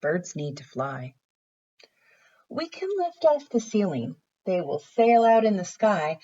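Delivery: gentle storybook read-aloud; reading to children. Acted. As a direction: read it like a picture book for little kids. Teacher reads picture book aloud.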